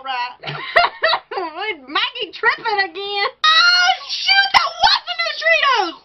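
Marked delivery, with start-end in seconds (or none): 3.5-5.9 excited. Real, not acted. Excited reaction.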